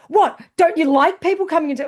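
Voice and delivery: imitating an aggressive drunk woman, loud and high; mock confrontational outburst. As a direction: challenge them, loud and pitched right up. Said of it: "She was really aggressive".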